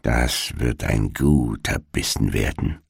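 low, soft greedy murmur; murmuring witch. A narrator's reading. Witch watching the sleeping children, softly murmuring. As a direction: mutter it to yourself over the sleeping children. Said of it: "so murmelte sie vor sich hin".

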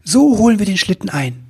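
selling a brilliant plan; enthusiastic. Acted. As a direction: elf excitedly planning the chase. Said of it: "rief er voller Begeisterung".

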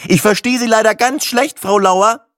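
loud, high-pitched irritated customer; irate call over a bad line; exasperated. A narrator's reading. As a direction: raise the voice down the phone. Caller reacts to refusal.

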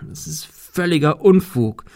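nervous, halting protest; stammering. A narrator's reading. Cecil stammering while climbing out the window. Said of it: "stammelte er".

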